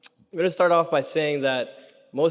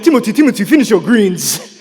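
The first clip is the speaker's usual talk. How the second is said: scolding parent; 4 semitones higher, 9 dB louder than usual. Retold or imitated. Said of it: "Even Africans would be like".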